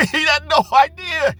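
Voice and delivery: high, loud laugh running into the words; real amused laugh about dad. Real, not acted. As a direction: laugh through it, slowing down. Laughs recalling his dad.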